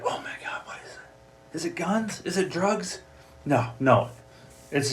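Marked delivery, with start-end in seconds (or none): 0.0-1.5 hushed excited whisper. Pretend. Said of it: "It's like". 1.5-3.0 higher, eager guessing agent. Pretend. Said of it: "It's like".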